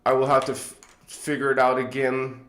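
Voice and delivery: usual speaking voice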